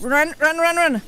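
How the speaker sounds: loud, high urgent run; urgent shout. Real, not acted. Gamer yelling at character to flee, urgent.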